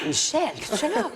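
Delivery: high-pitched, amused mock astonishment; astonished friend. Friend catching her copying the disposal, high mock astonishment. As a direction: imitate a startled friend, voice shooting up.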